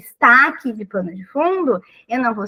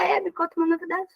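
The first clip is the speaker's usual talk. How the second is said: dry retort; 5 semitones higher, 6 dB quieter, much faster than usual. Retold or imitated. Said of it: "eu vou falar".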